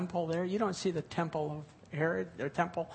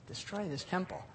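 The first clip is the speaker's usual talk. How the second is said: much softer, almost under the breath; softly repeated; 9 dB quieter than usual. Shown as a demonstration. Preacher repeating Jesus' words to the congregation, hushed. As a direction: let the words sink in quietly.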